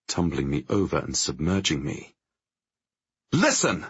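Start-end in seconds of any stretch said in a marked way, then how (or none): 3.3-3.9 sharp, high angry bark; angry snap. Acted. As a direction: cut them off hard. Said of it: "I snap".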